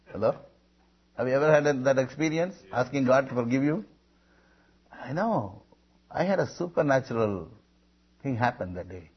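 normal talking voice

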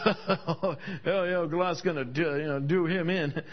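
low, laughing mock taunt; lightly voiced scoffers laughing; mocking brothers.

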